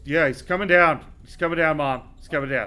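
loud call downstairs. Acted. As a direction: shout it down the stairs. Calling down to mom.

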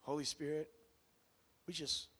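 soft, hushed prayer. Real, not acted. Preacher leading the church to pray to the Spirit, hushed prayer. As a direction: pray quietly and sincerely.

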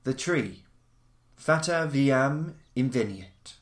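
ordinary delivery

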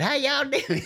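put-on very high squeaky kid greeting, laughing; high child's voice dissolving into laughter; little kid voice. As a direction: squeak it like a tiny boy, breaking into a laugh. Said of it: "That just sounded just like that".

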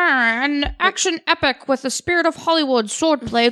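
lightly voiced vintage radio host; old-timey radio announcer. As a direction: read it like a 1940s radio announcer. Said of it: "make him like an old-timey radio announcer".

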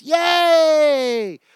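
high, loud mocking cheer; sarcastic yay. Real, not acted. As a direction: cheer it out to mock them. Sarcastic cheer mid rant.

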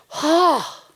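mock sigh of relief; relieved sigh. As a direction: let the fear drain out in one breath. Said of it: "this big sigh of".

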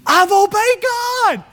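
slow, very high defensive squeal. Retold or imitated. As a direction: squeal the denial. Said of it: "He probably squealed a little bit".

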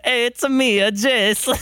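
Mario voice. Retold or imitated. Friend mocks Jason with a high hammy Mario voice. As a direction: bounce it like Mario saying a friend's name. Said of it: "Here's my impersonation of Jason".

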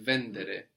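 Vendere with the accent on VEN; stress third from end. Shown as a demonstration. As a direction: hit the first syllable of "Vendere", falling after it. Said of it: "accent third back from the end".

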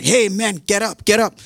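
imitating frantic shipmates; urgent wake-up call. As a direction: rouse a sleeper in a mock panic. Acted sailors waking sleeping Jonah.

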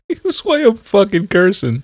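high, laughing repeat of his words; laughing echo. Real, not acted. Co-host teasing the ranter, laughing high. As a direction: giggle it back at him. Friend echoes him, laughing.

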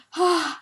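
loud, high gasp; acted shock gasp under a cold shower; imitated cold-water gasp. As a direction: gasp as if hit by icy water. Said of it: "Och bara står och så bara".

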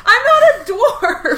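high, slow laughing objection; laughing protest. Real, not acted. Indignant denial, laughing.

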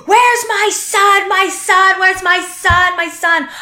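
high, drawn-out mock wail; retold scream. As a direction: imitate her screaming, pitched high and stretched out. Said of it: "she's screaming".

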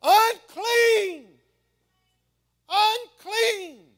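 loud, high anguished scream; screaming unclean. Acted. Leper screaming unclean to the crowd. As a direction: cry out in desperation. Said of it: "And he's screaming".

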